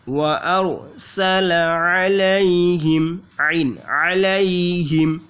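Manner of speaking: slow, drawn-out वअरसल अलैहिम; careful tajweed recitation; slow recited demo. Shown as a demonstration. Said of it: "demonstrates recitation".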